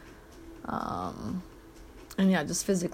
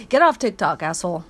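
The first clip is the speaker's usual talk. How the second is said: mock scolding; 8 dB louder than usual.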